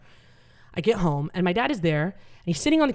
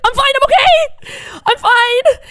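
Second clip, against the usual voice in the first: much higher and louder, slow and strained; mom's high squeaky stroke voice; 9 dB louder, slower than usual. Retold or imitated. Stand-up comic voicing mom insisting to the doctor, high, slow and strained. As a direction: squeak it out like the words barely come. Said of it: "I'm like".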